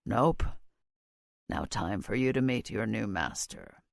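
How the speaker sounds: low, soft, sinister menace. A narrator's reading. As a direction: quietly relish the threat. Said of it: "in a sinister voice".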